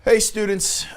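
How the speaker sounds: ordinary talk, nothing marked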